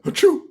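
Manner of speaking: high, sharp fake sneeze; mock sneeze. Ghost sneezing in the house, high fake sneeze. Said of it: "they're just like".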